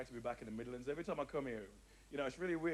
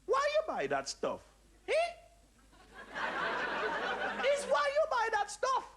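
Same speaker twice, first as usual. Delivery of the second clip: put-on high Jamaican accent, scolding then selling; a much higher scolding Jamaican lady; Jamaican cleaning lady; 4 dB louder than usual. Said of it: "she said".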